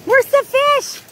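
high, eager call to the dog; excited sing-song to the dog. Real, not acted. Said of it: "repeat to dog".

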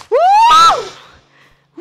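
very high, loud drawn-out scream; alarido de susto. Real, not acted. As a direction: shriek "uh" in alarm. Fighting the enemy. (chillido largo y agudo)

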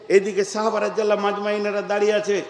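ordinary talk

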